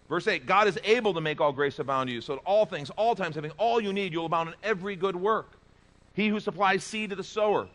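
high, loud, emphatic preaching; preaching at full force. Acted. Pastor urging the church to trust God, loud preaching. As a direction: drive the point home to the congregation.

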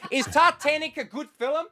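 high mock South African customer; South African accent. Video store customer asking clerk, high South African accent. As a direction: ask it in a comic South African voice. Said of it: "That's all I heard for eight years".

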